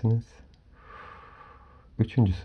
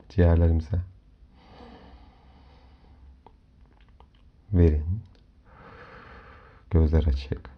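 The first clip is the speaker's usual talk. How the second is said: soft spa whisper; 3 semitones lower, 4 dB quieter than usual. Acted. Leads a guided relaxation. (yumuşak rehberli meditasyon sesi)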